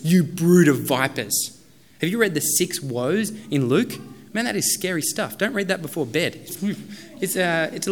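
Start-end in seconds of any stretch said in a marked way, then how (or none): 0.0-1.6 slow, louder condemnation; stern rebuke. Acted. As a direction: lay it down heavily on the Pharisees. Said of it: "the way that he deals with the Pharisees".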